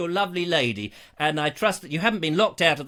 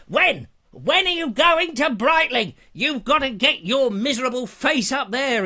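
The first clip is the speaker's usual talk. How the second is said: loud, high, hammed-up demanding viewer; mock-scold, pressing for an answer; Rupert Lloyd Thomas; 5 semitones higher, 3 dB louder than usual. Viewer ordering host up to Brightling, hammed-up loud demand. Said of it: "he says".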